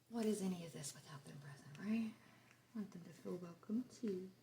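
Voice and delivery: breathy unvoiced aside; whispering. Real, not acted. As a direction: whisper it like a secret. Whispered aside about the spirits.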